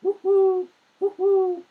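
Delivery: high, loud hooting woohoo; owl hoot. Acted. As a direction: call out like an owl in the night. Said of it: "Do you remember that from the story".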